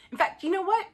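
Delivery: rally with a big rising question; sudden resolve. Acted. Sudden resolve to win spouse back.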